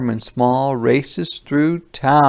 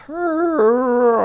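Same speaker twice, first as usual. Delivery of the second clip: joyful hooray; 13 semitones higher than usual. Acted. Mother rabbit cheers her bunny's rescue, high cheer. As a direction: cheer with pitch flying up. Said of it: "Mother Rabbit shouts".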